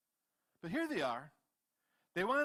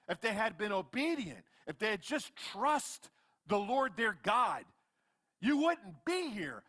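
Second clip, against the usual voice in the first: high, emphatic scolding rebuke; acted-out exasperated telling-off; ticked off; 5 semitones higher, 4 dB louder than usual. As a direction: scold them as a fed-up leader, pitched up. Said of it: "is getting ticked off".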